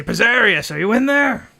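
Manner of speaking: high, loud call through a closed door. Acted. Rumney calls for Pizarius through the door, loud and high. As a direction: shout it at the shop door. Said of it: "and he'll say".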